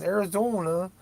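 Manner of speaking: high Minnesota ARIZONA with a long, rounded O; Minnesota accent. Shown as a demonstration. Showing the perfect word for it, Minnesota accent. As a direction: stretch the O in ARIZONA like a North Dakotan. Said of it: "is the perfect way to do that, uh, accent".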